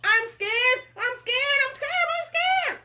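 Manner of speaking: very high, loud, escalating wail; terribly scared cook. Acted. Cook declares his terror of fishing, hammed-up panic. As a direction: climb into hammed-up cartoon panic. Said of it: "the cook declared".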